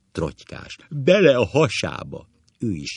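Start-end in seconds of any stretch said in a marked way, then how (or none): 0.9-2.3 high, loud gleeful shout; acted overexcited cry; excited interjection. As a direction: cap your chum's story loudly. Hysterical re-enactment, capping the story.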